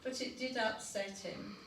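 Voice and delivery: ordinary delivery, nothing marked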